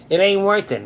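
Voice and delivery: emphatic. Real, not acted. Urging viewers not to let anger win, loud and emphatic. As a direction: drive the point in hard.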